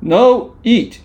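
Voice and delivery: put-on high, loud toddler refusal; a baby pushing food away, yelling; bratty baby voice. A narrator's reading. Reading to kids, shouting baby voice. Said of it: "said Baby".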